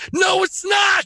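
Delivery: sudden high shouted no; emphatic shout. Real, not acted. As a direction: shout down the excuse. Rebutting "up to interpretation" claim.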